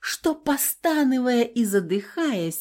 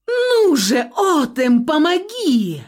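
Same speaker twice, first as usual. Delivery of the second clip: loud, high cry for help; powerful call; 7 semitones higher, 4 dB louder than usual. A narrator's reading. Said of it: "На удивление мощным голосом взывала".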